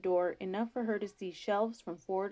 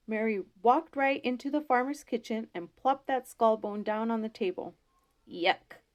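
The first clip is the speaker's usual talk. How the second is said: ordinary delivery, nothing marked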